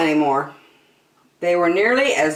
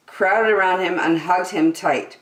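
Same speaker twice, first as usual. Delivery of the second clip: ordinary delivery, nothing marked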